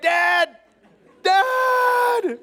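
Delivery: high, loud imitated toddler wail of Dad; mock toddler crying out. Dad imitating his two-year-old calling after him, high and shouting. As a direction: play the left-behind little brother calling out. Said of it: "Asher crying out because I left him".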